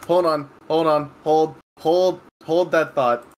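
usual speaking voice

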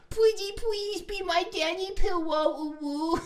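put-on slow, very high baby-talk plea; cutesy begging. Begging Danny to be a pillow, hammed-up cutesy voice. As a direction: squeak it out in mock-adorable pleading. Hams up big-eyed begging.

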